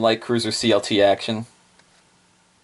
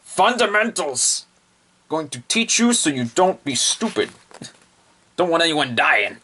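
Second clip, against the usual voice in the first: higher, emphatic bossy teacher voice; Oyodo's briefing; 6 semitones higher than usual. Acted. Oyodo lecturing the fleet, higher and emphatic. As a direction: drill the word like a strict instructor. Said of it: "She's like".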